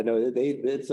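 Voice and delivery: ordinary talk, nothing marked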